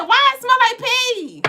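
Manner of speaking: high squeaky disgusted question; high comic voice asking about the smell; puzzled retort. Retold or imitated. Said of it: "I said".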